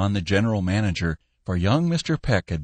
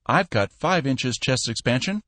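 ordinary delivery

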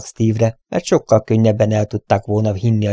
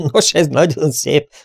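high, mocking laughter through the words; scornfully amused, voice lifted; laughing; 6 semitones higher, 3 dB louder than usual. A narrator's reading. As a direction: laugh in his face as you speak. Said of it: "kacagott Derrick".

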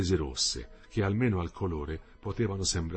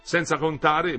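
higher, louder show of cheer; allegria sostenuta; 9 semitones higher, 7 dB louder, slower than usual. A narrator's reading. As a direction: keep up a cheerful tone. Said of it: "sostenendo il tono d'allegria". (tono allegro, ostentato)

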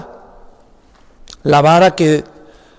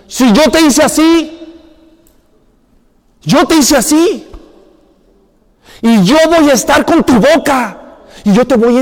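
higher, louder, firm divine voice; God's voice; 4 semitones higher, 3 dB louder than usual. Acted. God answering Moses' excuses, higher and louder, insistent. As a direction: speak as God settling the matter once and for all.

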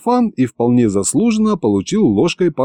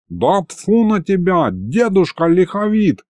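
higher, indignant retort without real volume; mock yell; 8 semitones higher than usual. A narrator's reading. Aristofan rebukes old Likhovid, in a mild indignant yell. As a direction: tell the old man off in a mild, drawn-out yell. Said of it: "заорал Аристофан".

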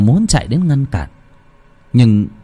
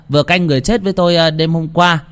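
higher-pitched mocking old neighbour; teasing jibe; 6 semitones higher than usual. A narrator's reading. Mr Hải teases the figure by the bamboo, mocking. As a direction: needle him with a laughing taunt. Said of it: "Ông lên tiếng châm chọc".

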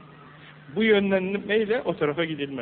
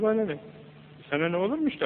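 normal talking voice

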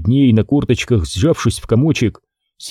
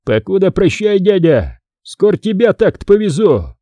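mock call out; 8 semitones higher than usual. A narrator's reading. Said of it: "крикнул".

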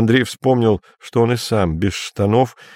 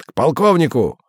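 barked order; 5 semitones higher than usual. A narrator's reading. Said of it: "рявкнул сержант".

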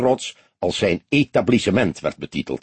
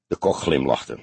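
plain reading voice

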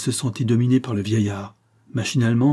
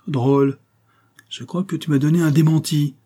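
raised, indignant voice; angry outcry; 6 semitones higher, 4 dB louder than usual. A narrator's reading. False marquis to the old servant who contradicts him, angry. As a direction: snap back at an insolent servant, loud and high. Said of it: "s'écria le marquis en colère".

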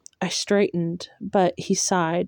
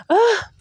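overwhelmed cry; 6 dB louder than usual. Real, not acted. Reader overwhelmed, shakes it off.

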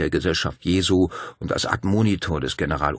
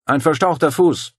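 called out higher and louder; calling out; 10 semitones higher, 7 dB louder than usual. Acted. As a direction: call across the camp to the doctor. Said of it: "rief Dario".

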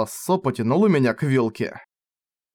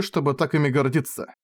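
plain reading voice

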